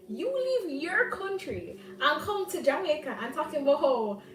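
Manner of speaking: high, loud, indignant telling-off; indignant retort. Retold or imitated. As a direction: scold him in a heated, high-pitched outburst. Acted angry retort to the man.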